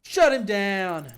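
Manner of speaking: very loud, drawn-out order; loud slow command. Acted. Player ordering the cop to hit, loud and slow. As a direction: bark it out slowly.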